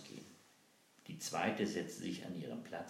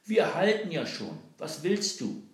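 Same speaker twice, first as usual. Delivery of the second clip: high, loud defiant yell; shouting back; 12 semitones higher, 12 dB louder than usual. Acted. Boy shouts back at the captain, loud and high. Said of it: "Balthasar schrie zurück".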